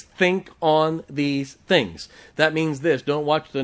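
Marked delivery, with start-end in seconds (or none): 0.0-2.1 slow, word-by-word scripture emphasis; slow and emphatic. Real, not acted. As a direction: space out each word deliberately. Drives home the verse's closing command.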